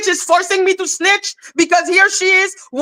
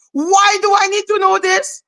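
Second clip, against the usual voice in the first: usual speaking voice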